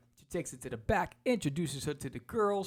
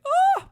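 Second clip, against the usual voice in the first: sudden high scream of fright; shrieking yelp; 14 dB louder than usual. Pretend. Playing dead when the Rock charges, high yelp. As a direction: yelp high and loud as if startled.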